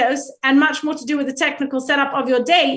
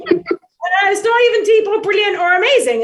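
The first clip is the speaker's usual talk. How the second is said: emphatic loud; 6 semitones higher, 4 dB louder than usual. Real, not acted. Emphatic rebuttal of own quote.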